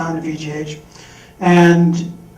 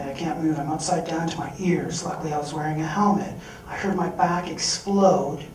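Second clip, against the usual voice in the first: ordinary delivery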